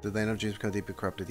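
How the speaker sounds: low, soft murmur of a read-back; reading back quietly. Retold or imitated. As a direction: read it under your breath, low. Streamer reads dialogue back to himself.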